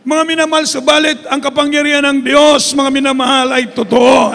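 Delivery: forceful preaching. Acted. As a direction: drive home that God's power is real. Preacher proclaims God's power.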